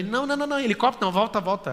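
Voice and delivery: high, urgent plea to turn back; retold panic. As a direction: act out frantic refusal mid-flight. Retold near-faint panic in helicopter.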